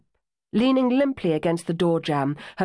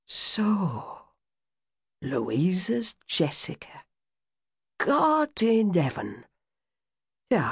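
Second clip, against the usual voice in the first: put-on older woman, moved; 3 semitones lower than usual. Aunt greets niece, moved.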